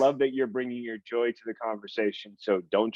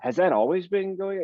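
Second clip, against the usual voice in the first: much higher-pitched, taken-aback question; retold surprised question; 10 semitones higher than usual. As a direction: ask it high, as if just noticing. Retelling her reaction to family teasing.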